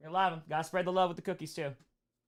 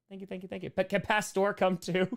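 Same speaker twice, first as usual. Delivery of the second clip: ordinary talk, nothing marked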